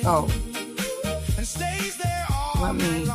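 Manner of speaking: ordinary talk, nothing marked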